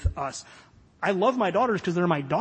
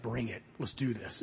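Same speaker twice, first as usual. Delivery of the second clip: quiet, low, serious resolve; softer and lower, deadly serious; intense; 6 semitones lower, 9 dB quieter than usual. Retold or imitated. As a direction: daughter steeling herself, quiet and intense. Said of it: "It's intense".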